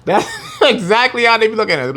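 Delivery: can't keep a straight face; real amused laugh running through it. Real, not acted.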